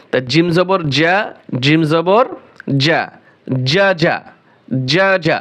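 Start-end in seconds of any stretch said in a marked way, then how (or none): none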